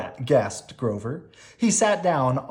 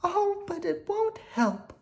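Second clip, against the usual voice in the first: very high, slow tearful princess voice; a princess moaning over her wall; wailing princess. Acted. Princess wails.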